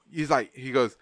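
usual speaking voice

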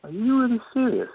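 can't believe she means it; disbelieving. Acted. Shocked she's quitting acting.

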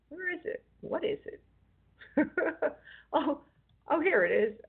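giggly, high and breathless; laughing while searching for it; giddy. Real, not acted. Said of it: "I sound a little giddy".

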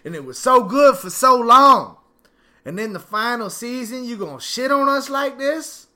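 loud, high outraged rant; angry rant. Real, not acted. Angry fan yelling at the show's writers, loud and high. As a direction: yell it at the writers, betrayed. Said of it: "I am angry".

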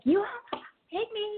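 high, slow mock scream; imitating an angry yell. Woman yelling at the driver, a high slow mock yell. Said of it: "using the F-bomb and everything else".

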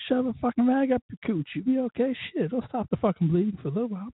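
high, cocky mock snap; hammed-up wiseass retort; mock crude advice. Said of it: "I'm like".

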